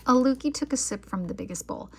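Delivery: plain reading voice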